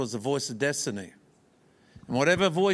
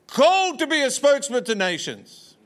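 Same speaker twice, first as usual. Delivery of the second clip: preaching emphasis; 12 semitones higher, 6 dB louder than usual. Acted. Preacher builds to the prophecy.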